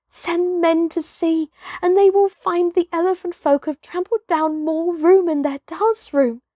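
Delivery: put-on a child insisting he saw it; child telling tale. Toomay telling the hunters his tale, high and eager. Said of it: "he told his tale in short words, as a child will".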